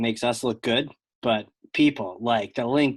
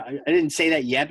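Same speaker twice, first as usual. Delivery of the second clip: ordinary talk, nothing marked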